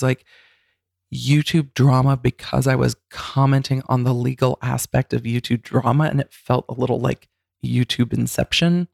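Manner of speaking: normal talking voice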